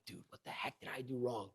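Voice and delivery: hushed, low whisper to oneself; whispered self-questioning. Retold or imitated. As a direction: whisper it privately, as if replaying your own mistake. Retelling muttered self-reproach at bedtime.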